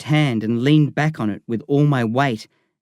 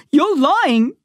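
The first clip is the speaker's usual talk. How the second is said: high accusing shout; boy shouting at Lyle as he leaves; 4 dB louder than usual. Acted. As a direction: fling the accusation at him. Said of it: "I shouted".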